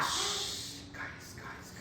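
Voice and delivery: urgent whisper, voiceless; whispered shush. Real, not acted. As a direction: hush them in a whisper. Hushing the chattering class.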